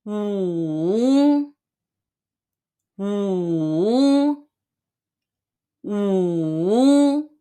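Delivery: 五 low and dipping; 五 in a low dipping third tone. Shown as a demonstration. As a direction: sink 五 low, then lift, third tone. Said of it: "the third tone".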